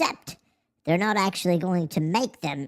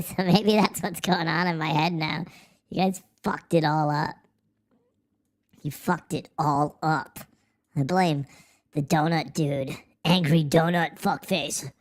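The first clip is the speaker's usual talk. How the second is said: ordinary delivery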